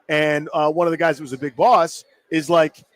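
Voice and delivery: ordinary delivery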